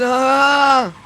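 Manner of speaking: startled yelp. Real, not acted. Slips in game, cries out.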